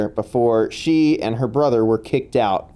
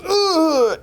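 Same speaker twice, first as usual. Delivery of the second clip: high winded groan; pained groan. Acted. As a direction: groan as if kicked in the gut. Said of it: "just goes".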